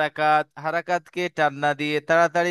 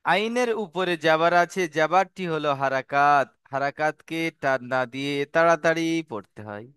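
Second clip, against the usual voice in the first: measured teaching recitation. Shown as a demonstration. Teacher spelling out the harakat rule to students, measured recitation.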